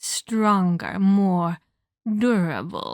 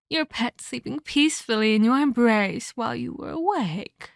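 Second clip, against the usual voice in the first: ordinary talk, nothing marked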